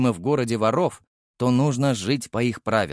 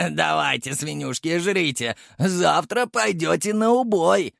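gloating taunt; 6 semitones higher than usual. A narrator's reading. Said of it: "ехидно ухмыльнулся".

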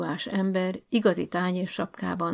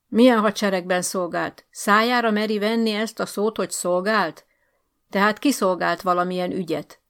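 loud, rising angry rant; indignant tirade; 5 dB louder than usual. Acted. Officer ranting at Bálint and the school, loud tirade. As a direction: tear into him.

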